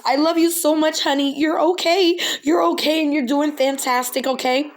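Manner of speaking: high, teary pleading; tearful. Real, not acted. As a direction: still crying as she comforts her. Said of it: "I'm so sorry".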